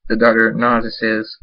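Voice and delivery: plain reading voice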